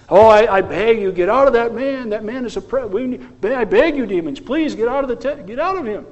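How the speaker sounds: high, stammering mock plea; mock begging. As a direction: play at begging, to show how absurd it is. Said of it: "Who's the one begging?".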